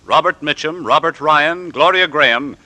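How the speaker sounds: ordinary delivery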